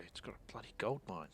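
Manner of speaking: ordinary talk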